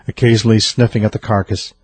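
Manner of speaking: ordinary talk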